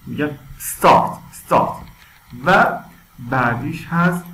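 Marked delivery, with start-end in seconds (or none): 0.6-1.8 "استارت" opening on a long s, no vowel before it; "استارت" as a loud English "sssta-rt"; drawn-out S. Shown as a demonstration. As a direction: stretch the s, then burst into "استارت".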